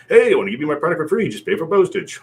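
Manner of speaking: fast, high, loud pitchman voice; infomercial guy. Retold or imitated. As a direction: sell it like a late-night TV pitchman. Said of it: "kinda do the infomercial guy".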